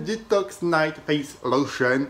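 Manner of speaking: put-on slow over-the-top English accent on the product name; exaggerated English accent, slow; mock English accent. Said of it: "T'as vu cet accent de ouf".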